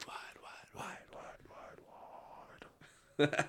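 fading whispered echo effect. Acted. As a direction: whisper it and let it fade. Whispered echoing catchphrase.

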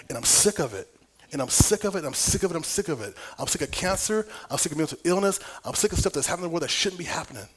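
loud, rising, fervent repetition; intense preaching. Acted. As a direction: build the outcry line by line. Building rant against the enemy.

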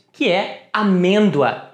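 normal talking voice

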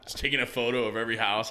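ordinary delivery

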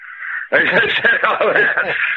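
high burst of laughter; hearty real laugh. Real, not acted. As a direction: really crack up. Laughs at retold reply.

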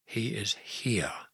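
slow, soft, near-whisper. A narrator's reading. Mary telling Joseph with a lump in her throat, whispered. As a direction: confess it softly and slowly. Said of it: "she whispered".

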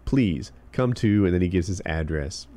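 normal talking voice

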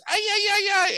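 very high loud mocking ay ay ay; a high, loud mocking Mexican cry. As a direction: wail it like a cartoon mariachi. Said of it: "puts on a sombrero and goes".

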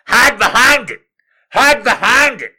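high, loud urgent shouting; mock frantic yell. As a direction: direct the actor in a panic. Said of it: "they're all like".

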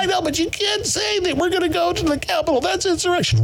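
high squeaky mock of the scolding critics; mocking quote. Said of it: "acted critic quote".

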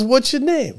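high cheerful grown-up; friendly director. Retold or imitated. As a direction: coax a shy kid. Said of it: "He goes".

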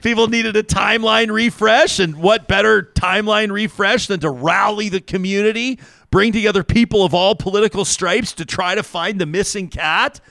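high-pitched, mock-incredulous rant; playful high-pitched speechifying; mock conspiracy rant. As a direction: build a silly theory in a high, insistent voice. Mock conspiracy rant about the cat.

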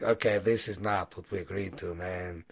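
a herded goblin muttering its complaint; grumbling goblin. Acted. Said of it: "goblins ... going".

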